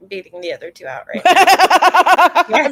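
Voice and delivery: slow, laughing through the words; giggling over an innuendo. Real, not acted.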